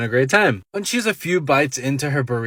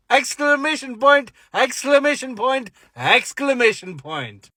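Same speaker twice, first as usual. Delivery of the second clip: emphatic; 9 semitones higher than usual. Retold or imitated. Reading out punctuation for emphasis.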